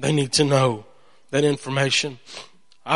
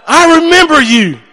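booming big man; 12 semitones higher, 8 dB louder than usual. Retold or imitated. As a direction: bellow it as the huge man who recognizes you. Imitates big man's booming greeting.

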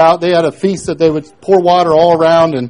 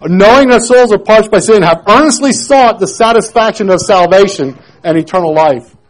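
loud, higher reading of scripture; preached scripture reading; 5 semitones higher, 8 dB louder than usual. Acted. Preacher reads the verses to the congregation, loud. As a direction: proclaim the verses with force.

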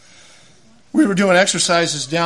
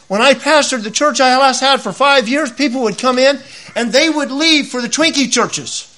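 ordinary talk, nothing marked

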